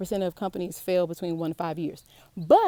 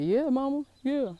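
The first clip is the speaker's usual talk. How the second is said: imitating her son; 6 semitones higher than usual. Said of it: "he's like".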